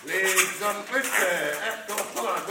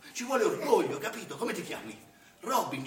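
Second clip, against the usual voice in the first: ordinary talk, nothing marked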